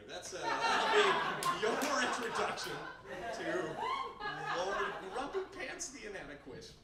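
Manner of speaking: slow, laughing through it. Real, not acted. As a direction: laugh out the punchline. Laughing punchline.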